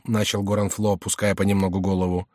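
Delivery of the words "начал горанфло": plain reading voice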